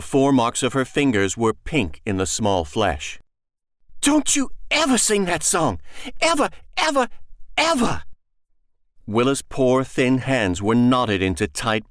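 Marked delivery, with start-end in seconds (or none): 4.0-8.0 much higher, frantic forbidding cry; furious mother. Acted. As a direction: stop the child cold, rising. Mother strikes child, forbids song.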